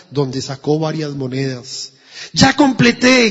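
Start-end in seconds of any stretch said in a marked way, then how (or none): 2.3-3.3 high, louder joyful cry; boy thrilled he has the money; joyful shout. A narrator's reading. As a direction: shout it out in glee. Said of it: "gritó jubiloso".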